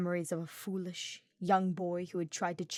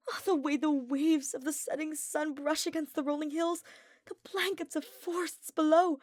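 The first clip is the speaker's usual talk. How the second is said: much higher, louder, gushing nobleman in awe; rapturous Ferdinand; 9 semitones higher, 3 dB louder than usual. A narrator's reading. Ferdinand calling Hubert to the cliff edge, gushing with wonder. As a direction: call him over, overflowing with wonder.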